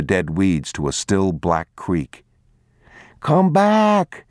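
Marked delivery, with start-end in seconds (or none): 3.2-4.2 put-on high, loud child's call; boy calling out. Boy calling to a stranger child, high and loud. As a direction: call out across the yard as a small boy. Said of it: "he called".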